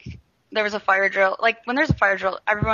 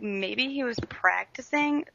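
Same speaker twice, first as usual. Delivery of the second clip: normal talking voice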